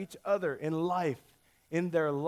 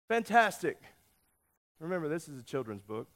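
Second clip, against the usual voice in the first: ordinary talk, nothing marked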